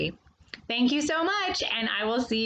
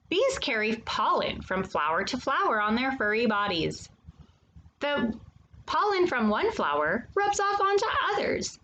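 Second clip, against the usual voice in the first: lively, sing-song picture book reading; reading to children. Acted. Storyteller reading a bee picture book to young children, animated and sing-song. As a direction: read it to the little ones like a storyteller.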